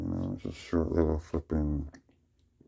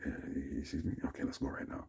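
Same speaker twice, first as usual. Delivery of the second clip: overheard whisper; 9 dB quieter than usual. Retold or imitated. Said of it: "I can hear them".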